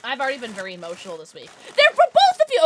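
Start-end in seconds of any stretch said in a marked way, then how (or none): 1.8-2.6 loud, high squeal of realisation; excited outburst. Real, not acted. Streamer realising who sent the plushies, excited outburst.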